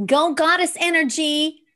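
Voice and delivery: high, loud whoop of support; excited high-pitched rallying cry; rallying cheer. Real, not acted. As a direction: cheer it on like a fan. Cheering on goddess energy.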